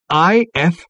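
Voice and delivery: high, loud single letters; excited spelling out. A narrator's reading. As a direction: call out each letter with mounting thrill. Letters appear, forming the great question.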